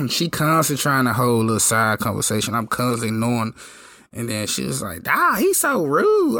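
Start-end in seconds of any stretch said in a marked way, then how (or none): 5.1-6.4 high mock girly voice; mimicking the woman. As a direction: voice the woman at the bar, pitched way up. Voicing the woman at the bar.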